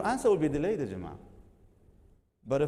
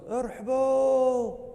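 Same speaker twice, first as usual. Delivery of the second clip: high, slow joyful shout; overjoyed son calling out to his mom; a son hears his mom on live TV and cries out for joy; joyful cry; 12 semitones higher, 5 dB louder, much slower than usual. Retold or imitated. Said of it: "He goes".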